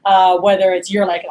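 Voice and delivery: ordinary talk, nothing marked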